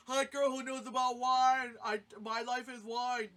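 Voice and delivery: loud mocking imitation of a besotted dude; hammed-up love-struck dude; mock smitten guy. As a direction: mimic a guy thrilled by his luck.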